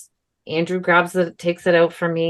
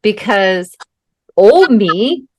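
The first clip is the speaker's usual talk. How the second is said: normal talking voice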